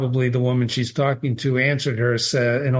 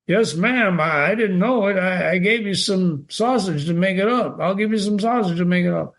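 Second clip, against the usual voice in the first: higher, stammering apology; lightly voiced flustered butcher; 5 semitones higher than usual. A narrator's reading. Butcher apologising to angry customer, higher stammering. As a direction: hurry to placate her.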